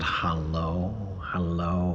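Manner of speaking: low, soft, sultry radio voice; sultry Sally. Retold or imitated. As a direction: purr it low like a seductive broadcaster. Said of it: "the lower they speak, the more sexy they sound".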